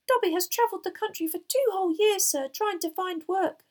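high, loud house-elf squeak; earnest little elf, pitched very high. A narrator's reading. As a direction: squeak it out emphatically, slowing at the end. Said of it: "Dobby squeaked".

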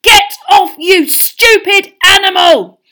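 high, very loud angry shout; furious roar. A narrator's reading. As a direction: bellow at an attacking animal. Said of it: "Ron roared".

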